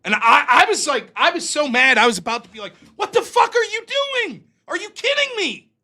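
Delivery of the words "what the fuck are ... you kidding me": much higher, incredulous fury; outraged yell. Retold or imitated. Teen furious at the mother who ignored her toddler, high and outraged. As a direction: let her have it.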